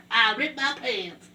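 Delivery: lightly voiced SpongeBob. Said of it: "That's SpongeBob".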